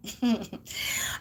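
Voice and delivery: quiet closed chuckle; soft laugh. Real, not acted. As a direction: let out a small, unamused chuckle. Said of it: "I'm laughing. It's not funny".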